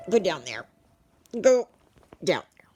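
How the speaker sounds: telling the dog off at her feet; firm command. Real, not acted. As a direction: send the dog down, sternly. Ordering the dog away.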